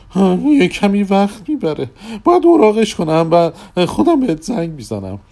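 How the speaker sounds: high, loud put-on voice; magician character voice. A narrator's reading. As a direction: play the puzzled magician, pitched well up.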